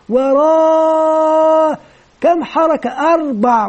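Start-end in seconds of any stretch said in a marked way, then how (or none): 0.1-1.8 "ورا" with the final alif sung very long, pitch held high; drawn-out madd. Shown as a demonstration. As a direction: stretch the alif of "ورا" far beyond two beats. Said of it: "المد هنا أطول من حركتين". (مدّ ألف "ورا" طويلًا جدًا بصوت منغّم)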